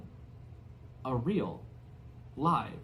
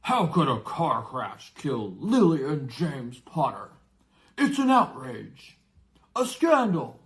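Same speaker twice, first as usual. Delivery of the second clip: outraged giant; 8 dB louder than usual. Acted. Giant rages at the relatives over the lie. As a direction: thunder your indignation.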